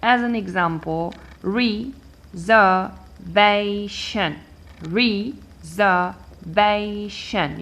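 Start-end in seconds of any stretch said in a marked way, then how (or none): 1.4-4.4 reservation in four slow, separate beats; syllable by syllable. Shown as a demonstration. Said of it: "three or more than three syllables". 4.8-7.6 Reservation in four slow, separate beats; syllable by syllable. Shown as a demonstration. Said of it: "four syllables".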